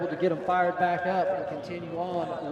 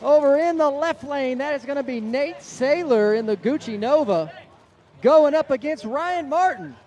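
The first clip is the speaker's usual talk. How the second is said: play-by-play; 9 semitones higher, 6 dB louder than usual. Real, not acted. Live race announcing.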